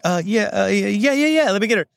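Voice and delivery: high, hurried yeah-yeah of a caught-out receptionist; flustered receptionist. Retold or imitated. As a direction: play the gatekeeper pretending she knows you. Gatekeeper fumbling to cover.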